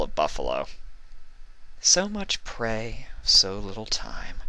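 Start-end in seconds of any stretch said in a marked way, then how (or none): 1.8-4.4 slow menacing relish; predatory thought. A narrator's reading. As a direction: savor the hunt. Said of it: "she thought".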